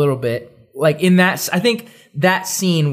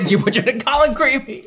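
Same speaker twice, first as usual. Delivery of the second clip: high, hammed-up demand; comic accusing yelp; frantic mock questioning; 7 semitones higher than usual. Dumbledore grilling yet another student, mock frantic.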